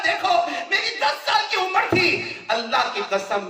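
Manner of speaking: loud, high, driving sermon narration; impassioned sermon; full-force preaching. Acted. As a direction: paint the caravan scene for the crowd at full voice. Preaching mother's and sheikh's words.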